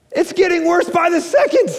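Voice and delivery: sudden jump up in pitch, mock-exasperated; mounting exasperation. Real, not acted. Pastor to congregation, rising exasperation. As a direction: climb high as it gets worse.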